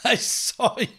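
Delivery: laughter leaking through the words; talking through suppressed giggles; stifled giggles. Real, not acted. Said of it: "I'm trying not to laugh out loud".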